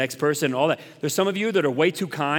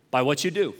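normal talking voice

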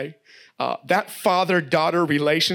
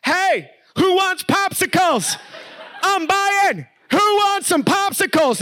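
put-on much higher, louder, slowed child's call; little girl yelling; 14 semitones higher, 4 dB louder, slower than usual. Daughter calling all the cousins to the popsicle stand, shouting. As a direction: shout it out across the pool like a kid. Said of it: "she yells".

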